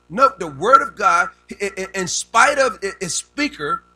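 loud, slow pulpit proclamation; forceful preaching. Real, not acted. Preacher telling the congregation about God's word, forceful preaching. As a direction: hammer out each phrase to the room.

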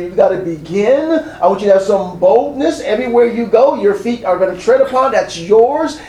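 rising, fervent preacher's call; full-force preaching. Acted. Preacher voicing God to the church, fervent and rising. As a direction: preach it out to the congregation with growing fire. Preaching God's charge to Joshua.

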